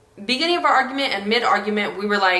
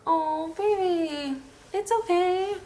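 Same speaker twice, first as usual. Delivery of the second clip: soft, hushed and higher, comforting her partner; tender comfort; 7 semitones higher, 9 dB quieter than usual. Real, not acted. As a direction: gently soothe someone who is upset. Partner comforting him while he cries.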